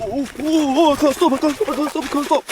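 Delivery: high panicked shriek while running. Real, not acted. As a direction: squeal as you lose control downhill. Running downhill, can't stop.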